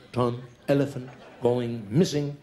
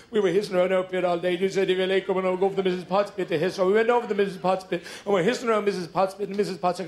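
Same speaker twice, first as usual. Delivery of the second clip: put-on whiny baby snakes; 4 semitones higher, faster than usual. Baby snakes complain to mother.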